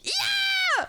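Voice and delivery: high laughing yell; laughing shout. Real, not acted.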